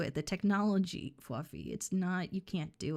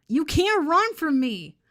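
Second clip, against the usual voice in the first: high, loud, slow threat; mock menacing yell; 9 semitones higher, 11 dB louder, slower than usual. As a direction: bellow it like a cartoon stalker. Threatening the fleeing pet.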